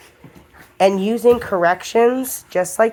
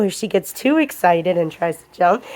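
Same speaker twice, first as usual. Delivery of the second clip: usual speaking voice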